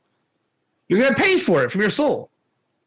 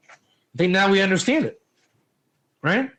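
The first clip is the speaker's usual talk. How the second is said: ordinary talk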